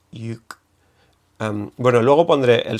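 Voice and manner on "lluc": Lluc whispered, with the Catalan ll. Shown as a demonstration. Teacher whispering how Catalans say Lluc. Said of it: "en catalán sería Lluc".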